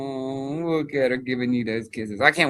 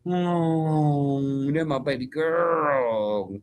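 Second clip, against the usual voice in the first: slow cooing; much slower than usual. Real, not acted. Cooing at dog on screen.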